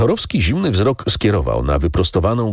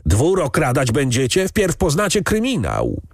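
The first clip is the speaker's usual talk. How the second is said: angry cursing; 8 semitones higher than usual. A narrator's reading. Count threatens the farmhands with jail, angry cursing. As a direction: rail at them without shouting. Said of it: "Zaczął kląć po swojemu".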